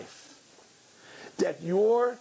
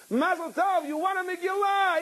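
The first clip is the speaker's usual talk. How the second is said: excited announcement; slower than usual. Retold or imitated. Caller announcing win, high.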